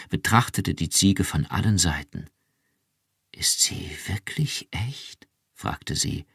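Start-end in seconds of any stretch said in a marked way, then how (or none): 3.3-5.3 flüstern. Acted. Staunende Frau fragt ungläubig.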